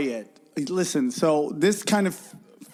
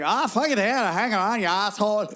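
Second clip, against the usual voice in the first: hammed-up Southern accent, pitched up; 3 semitones higher than usual. Shown as a demonstration. Comic showing off his accents on request, Southern accent. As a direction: put on a rough Southern accent. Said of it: "All right, Southern".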